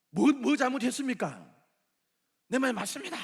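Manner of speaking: loud, high, indignant protest; indignant shout. Acted. Jonah shouts indignantly back at God. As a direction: shout back like a sulking prophet.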